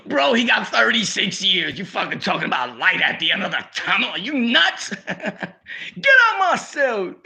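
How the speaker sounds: mock the advice, then laugh him out; laughing outrage. Cellmate retorts angrily, laughing.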